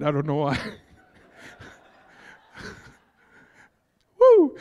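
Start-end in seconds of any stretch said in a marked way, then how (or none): 4.2-4.6 a real whoop, much higher and louder; excited whoop. Real, not acted. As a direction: let the joy burst out in one whoop. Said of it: "I just got excited".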